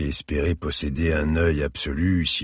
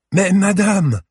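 higher and louder, beaming exclamation; exclaiming with a big smile; 8 dB louder, slower than usual. A narrator's reading. Little girl recognises the lady at the museum: beaming exclamation. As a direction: burst out happily, smiling. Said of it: "s'exclama l'enfant avec un grand sourire".

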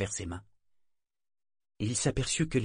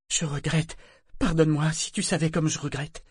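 higher-pitched, grieving apology; remorseful pleading; 7 semitones higher than usual. A narrator's reading. Boy apologising to the dead helmsman, pleading remorse. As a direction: plead for forgiveness.

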